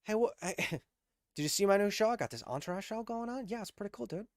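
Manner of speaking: high, soft actor impersonation; imitated casual star small talk; Mark Wahlberg. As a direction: chat away in a high, hushed mock celebrity voice. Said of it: "Mark Wahlberg is like".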